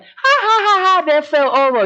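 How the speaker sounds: high, loud mocking cackle; loud mock laughter, pitched high. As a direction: jeer at someone who slipped. Mocking laugh at fallen guest.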